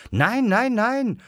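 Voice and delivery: high-pitched rapid string of denials; insistent assurance. A narrator's reading. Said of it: "versicherte Thomas". (hoch und beteuernd)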